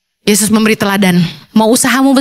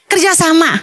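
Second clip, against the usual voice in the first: usual speaking voice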